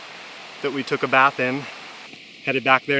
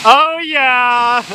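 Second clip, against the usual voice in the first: cheering; 11 semitones higher than usual. Real, not acted. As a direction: whoop it up from a distance. Cheering friend from afar.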